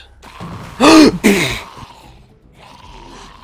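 sharp gasp of fright; startled gasp. Real, not acted. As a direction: gasp as if caught off guard. Sudden jump scare in game.

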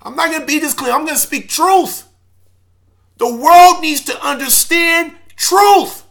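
impassioned outburst. Real, not acted. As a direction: pour out a wounded anger, pitched high. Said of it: "I got emotional".

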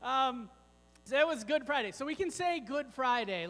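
ordinary talk

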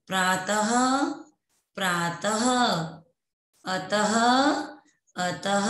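पुनः, नमः, प्रातः, अतः each ending in "ha", long pauses between; visarga drill. Shown as a demonstration. Teacher leads children repeating, final "ha" on पुनः, नमः, प्रातः, अतः. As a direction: say each word alone with an audible final "h", as in अतः. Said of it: "है की ध्वनि निकलती है".

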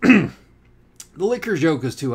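sudden excited aside. Real, not acted. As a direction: break in with a sudden idea. Author breaks into the story.

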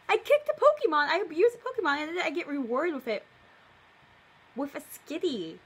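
loud indignant rant, speeding up; outraged exclamation. Real, not acted. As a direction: shout it in mock horror. Outraged at her story's logic.

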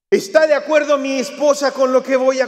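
high, loud, laughing disbelief; laughing mock question. Preacher mocking the idea of asking his wife, laughing loudly.

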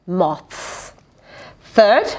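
ordinary delivery, nothing marked

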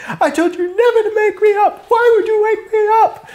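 very high, loud whiny squeal; squealing mock Boyle. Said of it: "Boyle goes".

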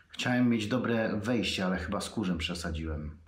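ordinary delivery

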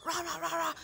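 high-pitched mock rant; put-on road-rage voice, pitched right up; imagined angry driver. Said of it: "whether they're gonna be like".

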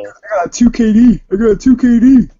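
loud, high-pitched trash-talk yell; hammed-up shouted boast; mock gamer bragging.

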